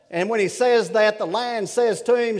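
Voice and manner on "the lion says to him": normal talking voice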